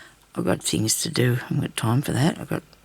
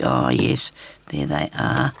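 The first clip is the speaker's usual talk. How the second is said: inner voice; 13 semitones lower, 4 dB louder, slower than usual. Retold or imitated. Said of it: "you think".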